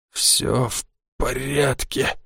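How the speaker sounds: slow, quiet rasping reassurance; a hoarse, breathless whisper of "all fine"; hoarse wheeze. A narrator's reading. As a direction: croak it out, barely voiced and dragging. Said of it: "прохрипел он".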